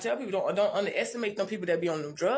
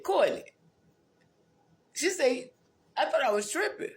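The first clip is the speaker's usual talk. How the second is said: raised voice for the friend in jail; lightly voiced old friend, pitched up; voicing her friend; 6 semitones higher than usual. Recalling her friend greeting her in jail, lightly voiced higher.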